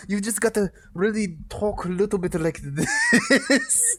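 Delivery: high, slow mock Russian accent; badly faked Russian, on the edge of a laugh; best Russian attempt. Shown as a demonstration. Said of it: "That's my best Russian".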